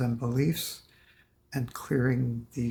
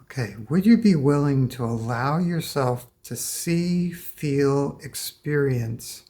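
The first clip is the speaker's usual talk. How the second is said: guided meditation. Acted. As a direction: invite them gently, one step at a time. Guides client through release.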